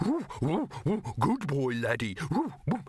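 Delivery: put-on high cooing good-boy voice, far up; doggy baby talk. Old dog mimicking a happy pup, cooing. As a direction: coo at the dog like an excited pup.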